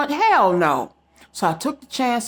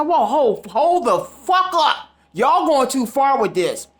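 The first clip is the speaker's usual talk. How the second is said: raised, slowed "hold the fuck up", pushing back; indignant objection; 4 semitones higher, slower than usual. Retold or imitated. To officials taking her cat, a rising indignant protest. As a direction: stop them cold, voice climbing.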